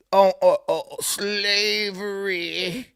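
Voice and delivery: slavery stretched out slowly, mockingly; mocking drawl. Host mocks the excuse people make, slow sarcastic stretch. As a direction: drag the word out like a tired excuse.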